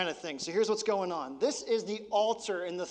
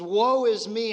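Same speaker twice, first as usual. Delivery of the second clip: Woe is me cried out much louder; loud lament; 3 semitones higher, 10 dB louder, slower than usual. Acted. Pastor crying out Isaiah's lament, loud and anguished. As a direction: cry out Woe is me as a wail of despair. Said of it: "He goes".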